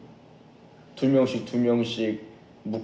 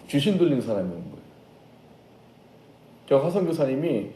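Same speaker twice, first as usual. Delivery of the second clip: normal talking voice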